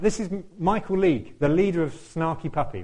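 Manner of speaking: ordinary delivery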